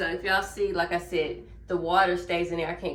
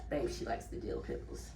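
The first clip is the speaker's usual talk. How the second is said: whispered aside; 8 semitones lower, 9 dB quieter than usual. Real, not acted. Aside about partner.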